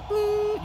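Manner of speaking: a high short boop; one high-pitched beep; imitated beep. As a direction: mimic the monitor's beep.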